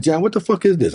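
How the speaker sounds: high-pitched, retold outrage; quick indignant challenge; indignant demand. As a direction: demand an explanation, voice jumping up. Retells confronting the Senate president.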